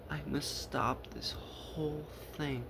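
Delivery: soft, plotting whisper; scheming Grinch whisper. Acted. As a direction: mutter the plan to yourself. Grinch speaks.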